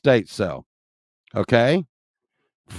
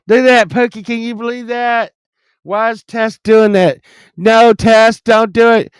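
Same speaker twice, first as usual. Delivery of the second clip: high, loud playful scolding; excited mock scolding; 9 semitones higher, 7 dB louder than usual. Real, not acted. Talking to the model in the photo, high playful scolding. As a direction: call out to the photo, higher and louder.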